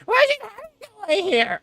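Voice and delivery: a quick mocking falsetto impression; high-pitched voice. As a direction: parody the shrill sidekick line. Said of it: "speak in the high-pitched voice".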